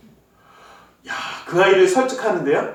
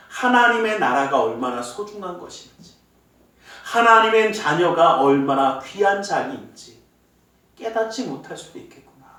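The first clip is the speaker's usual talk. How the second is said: normal talking voice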